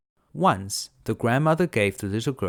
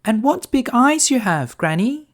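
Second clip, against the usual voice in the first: put-on much higher, bright-eyed little girl; 8 dB louder than usual. Girl answering the wolf, high and innocent.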